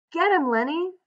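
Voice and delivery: high-pitched urgent yell. A narrator's reading. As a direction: yell it high, as through cupped hands. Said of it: "cupped his hands around his mouth and yelled".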